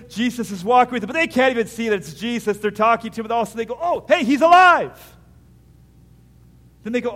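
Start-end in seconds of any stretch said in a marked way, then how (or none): 3.8-5.2 high, loud burst of discovery; joyful surprise. Acted. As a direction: gasp it out like seeing him alive. Disciples recognizing risen Jesus.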